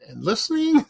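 usual speaking voice

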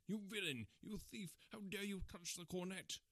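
high-pitched, furious accusation; outraged scream. A narrator's reading. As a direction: cry out in shock and rage. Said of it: "I screamed".

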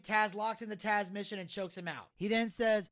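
plain reading voice

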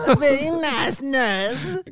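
high, slow mock Southern cry; mocking Southern American. Teasing his co-host's attempt in an over-the-top Southern voice. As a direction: ham it up like a crying Southern belle. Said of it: "mocking co-host's accent".

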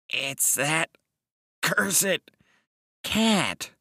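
high, strained, halting character voice; injured little man cursing; groaning Mister Wilde. A narrator's reading. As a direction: groan it out in pieces, pitched high. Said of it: "Mister Wilde lay groaning".

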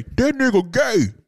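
loud, high, slow jeering call; mocking bully. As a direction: sneer it out to the whole room. Bully mocks him at school.

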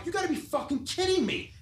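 high, exasperated fall; can't believe this guy; exasperated disbelief. Retold or imitated. Tattooer argues in the shop's front room, exasperated. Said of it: "I'm like".

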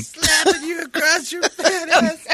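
high, slow, laughing through it; laughing mimicry. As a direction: repeat it while cracking up. Mimicking Brady's line while laughing.

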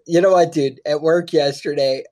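normal talking voice

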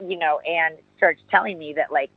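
ordinary delivery

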